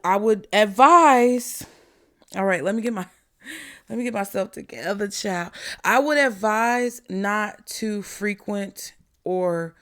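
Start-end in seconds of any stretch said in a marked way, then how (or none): none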